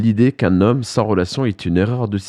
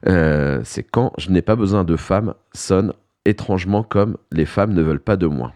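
ordinary delivery

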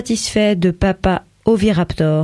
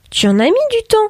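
much higher, pointed mother's remark. A narrator's reading. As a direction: needle him, mildly impatient. Mother Euphémia comments.

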